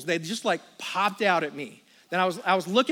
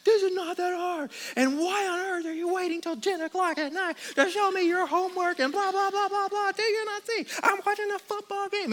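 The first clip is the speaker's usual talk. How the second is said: much higher, whiny tantrum voice; mock temper tantrum; 11 semitones higher than usual. Dad throwing a tantrum at his son over homework, high whiny. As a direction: whine like a two-year-old throwing a fit. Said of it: "And I go".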